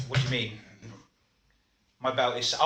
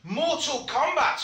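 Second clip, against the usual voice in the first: announcer voice; 10 semitones higher, slower than usual. Acted. Announcing the game title.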